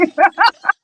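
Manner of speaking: short, high laugh; real laugh. Real, not acted. After teasing her gently before listeners, real laugh.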